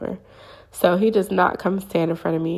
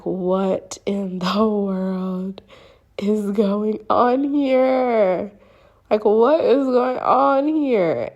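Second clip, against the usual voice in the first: dumbfounded; 2 semitones higher, much slower than usual. Retold or imitated. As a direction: stretch every word in acted bewilderment. Acting out shock after disappearance.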